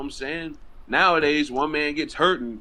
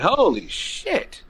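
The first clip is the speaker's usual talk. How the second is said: drawn-out, higher-pitched amazement; amazed reaction; 9 semitones higher, much slower than usual. Retold or imitated. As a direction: stretch both words out in awe. Repeating amazed reaction to ovation.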